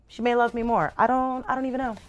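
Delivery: ordinary delivery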